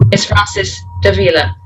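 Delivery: usual speaking voice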